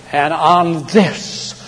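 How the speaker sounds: very slow, weighty preaching; emphatic declaration. Real, not acted. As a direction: land each word slowly and firmly. Preacher solemnly rests his case.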